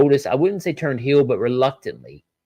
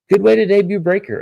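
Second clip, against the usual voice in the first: excited; 9 semitones higher, 7 dB louder than usual. Real, not acted. Excited praise of the debut.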